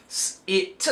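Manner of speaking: "s, it" broken into separate phonics sounds with gaps; sounding out letters. Shown as a demonstration. Teacher modelling how children spell sit, "s, it" sound by sound. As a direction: split "s, it" sound by sound. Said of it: "I will say something like sit".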